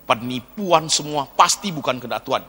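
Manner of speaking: normal talking voice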